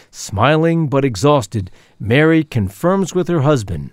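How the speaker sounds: normal talking voice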